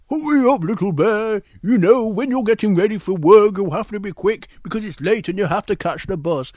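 storybook Big Bear, urging; hurrying Big Bear. Acted.

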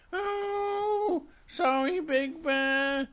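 high little bear voice; a small, high-pitched sorry; apologetic Little Bear. Acted. Little Bear apologises to Big Bear, sheepish.

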